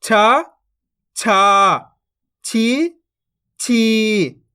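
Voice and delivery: syllable drill, short rising then long level-falling vowels; barahkhadi recitation. Shown as a demonstration. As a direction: recite each syllable apart for pupils to repeat, stretching every second one. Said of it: "मैं किस तरीके से इनको पढ़ रहा हूँ". (बारहखड़ी का पाठ, एक-एक अक्षर खींचकर)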